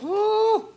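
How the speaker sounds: high, loud woo of delight at the taste; delighted whoop. Real, not acted. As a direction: let out a loud, high woo after the bite. Pastor tastes the food.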